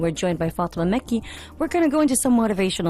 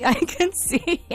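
laughing; 3 semitones higher, slower than usual. Real, not acted. Laughing at colleague in booth.

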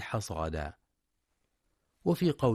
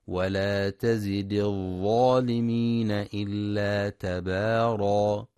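slow, louder melodic tajweed chant; Quran recitation; 5 dB louder, slower than usual. Acted. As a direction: chant the verse with long held vowels.

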